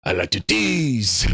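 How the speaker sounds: mock French accent, slow and coy; lightly French-accented tease, drawn out. Streamer doing Herman's French accent tease, light mock French. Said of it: "he does his French accent when he teases".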